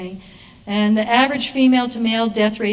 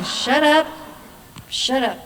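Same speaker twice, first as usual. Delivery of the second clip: mock exasperated, raised-pitch silencing; exasperated retort; 4 semitones higher, slower than usual. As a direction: cut the nagging voice off, higher. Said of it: "And I'm going".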